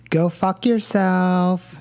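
put-on slow, drawn-out valley girl put-down. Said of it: "in the most valley girl way that I can".

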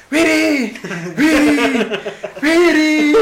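high, loud retold yelling with a laugh; yelling a name. Friend Ale yelling for Viri to come out, high laughing shout. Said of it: "Ale empieza a gritar".

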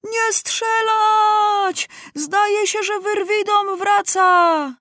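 very high, slow panicked cry; frightened shout. A narrator's reading. Lieutenant panicking as his men fire at the boy. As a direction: scream it in terror to stop the fire. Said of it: "aż się zatrząsł ze strachu".

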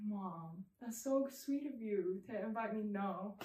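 very soft, low acted refusal; strained politeness. Daughter declining mom's invitation to evangelize, soft and low. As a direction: turn her down quietly under your breath.

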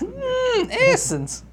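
high savoring monster voice; hungry monster. Pretend. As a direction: taste the words like a beast.

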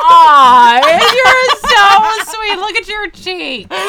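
cooing. Real, not acted. Cooing over friend's cheeks.

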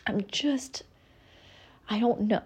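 breathy whispered exhaustion, trailing off; tired whisper. Retold or imitated. To her therapist, recalling burnout, a worn-out whisper. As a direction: sigh it out like you're spent. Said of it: "I leave it being like".

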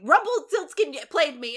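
high, loud mock outrage; acted yelling. Emma telling Neil she was played, acted yelling. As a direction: burst out with the accusation, voice jumping up. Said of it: "lots of yelling in this episode".

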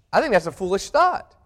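ordinary talk

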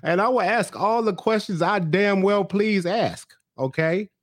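mock Johan. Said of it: "he was like".

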